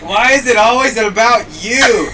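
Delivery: loud, high, drawn-out accusation; hammed-up wounded outrage; retold reproach. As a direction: imitate an aggrieved girlfriend, slow and loud. Retold girlfriend's angry reproach.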